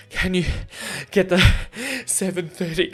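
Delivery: panting, laughing through the question; gasping between words; out of breath. Retold or imitated. To the mechanic on the phone, breathless and laughing. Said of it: "I was, like, so out of breath".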